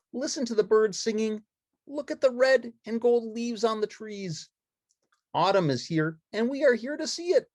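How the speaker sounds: high, bright-eyed puppet chatter; Ernie voice. Acted. Ernie showing friends the autumn woods, high and eager.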